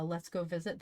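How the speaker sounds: ordinary talk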